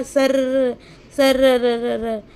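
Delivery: long drawn-out rolled "sarrr"; imitated gliding sound. Teacher telling children how the boat glides, drawn-out sound effect. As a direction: stretch it out like a boat sliding off on water.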